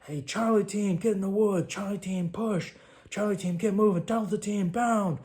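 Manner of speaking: high, loud battlefield commands; shouted orders. Retold or imitated. Team leader yelling orders to the squads, loud and high. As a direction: bark the orders over gunfire.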